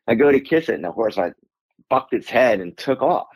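ordinary delivery

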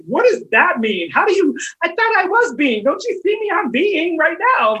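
high, indignant confusion; baffled protest. Retold or imitated. Confused by her mentor's advice, bewildered protest. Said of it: "I was like".

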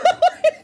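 a sudden high laugh at herself; high burst of laughter. Real, not acted. Laughing at own rant.

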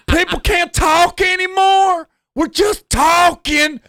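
high, slow indignant cry; protesting girlfriend. Retold or imitated. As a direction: plead innocence to the whole street.

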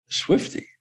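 whispered, disbelieving Swifty? with a rising tune; stunned whisper. Retold or imitated. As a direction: breathe Swifty? in shock. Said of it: "And I went".

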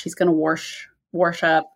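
ordinary talk, nothing marked

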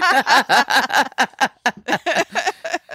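high, bright laugh at the pun; laugh, catching the joke; amused laugh. Real, not acted. Catching the interviewer's pun, an amused laugh.

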